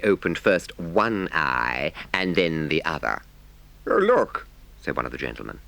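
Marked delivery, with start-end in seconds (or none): 3.9-4.5 higher, louder gentleman calling attention; a touch of a keen gentleman; lightly voiced gentleman. Gentleman speaks in the story.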